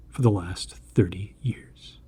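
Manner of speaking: plain reading voice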